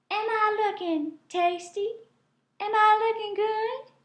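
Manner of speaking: high, coy, teasing voice; flirty teasing possum. A narrator's reading. Possum taunting the hungry gator, high and coy. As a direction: tempt him, knowing he can't reach. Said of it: "she calls as she swings".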